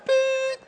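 high, steady held beep; pitch pipe beep. Retold or imitated. Non-instrumental brother giving the note, high pitch-pipe toot. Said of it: "you got the pitch pipe".

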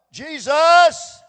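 desperate loud cry of the lepers. Acted. Lepers calling out to Jesus, desperate shout.

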